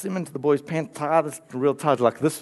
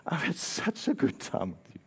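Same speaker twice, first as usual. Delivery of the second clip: soft tender; 8 dB quieter than usual. Retold or imitated. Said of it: "and says".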